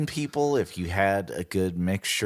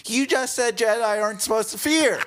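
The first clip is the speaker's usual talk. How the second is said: much higher, louder and fast in disbelief; incredulous; 14 semitones higher, 4 dB louder, faster than usual. Retold or imitated. Host calling out Yoda's hypocrisy, high incredulous. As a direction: squeal it, catching him out.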